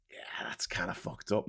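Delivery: hushed aside whisper; whispered shocked aside. Real, not acted. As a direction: drop to a whisper in disbelief. Aside after shocking fact.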